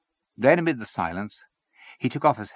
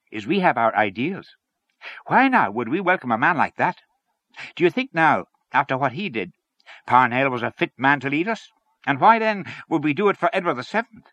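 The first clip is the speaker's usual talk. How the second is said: higher, pressing rhetorical questions; earnest, raised character voice; argumentative Lyons; 6 semitones higher, 4 dB louder than usual. Acted. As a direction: press the objection point by point. Voices Lyons arguing in story.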